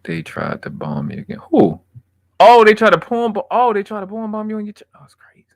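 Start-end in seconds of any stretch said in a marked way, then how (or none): 1.5-4.8 high, loud alarmed yelp; rising shocked exclamations, voice up high; shocked surprise. Real, not acted. Reacting to being bombed, high and loud. 4.9-5.5 whisper. Real, not acted. Whispered aside.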